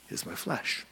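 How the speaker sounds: dropped to a hushed whisper. Real, not acted. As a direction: let the words fall into a whisper. Pivotal line dropped to a hush.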